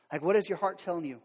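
normal talking voice

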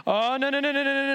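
high, alarmed run of no's from the pro-Paul group; panicked protest. Acted. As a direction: plead in dismay, pitch pushed up. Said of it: "How do you think the pro-Paul group felt".

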